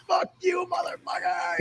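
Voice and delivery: high, strained mock shout; mock outburst. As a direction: imitate a foul-mouthed eruption at reduced volume. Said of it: "as soon as the show starts, he's like".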